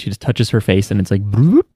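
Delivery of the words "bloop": little rising bloop sound effect; imitated bloop. Host mimics a magical healing touch, imitated bloop. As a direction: make a bloop that slides upward.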